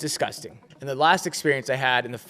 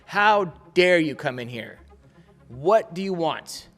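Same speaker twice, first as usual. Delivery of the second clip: slow, unfriendly demand; mock hostility; 3 semitones higher, 4 dB louder, slower than usual. Worker to a waiting customer, mock hostility. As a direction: spell out each word with open disdain. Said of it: "No friendliness, no nothing. Just like".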